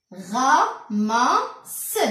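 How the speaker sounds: "Ramasse" broken into ra, ma, sse; drawn-out "Ramasse" for beginning readers; sounding out syllables. Shown as a demonstration. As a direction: say "Ramasse" slowly in three beats. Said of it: "on va lire tout ça".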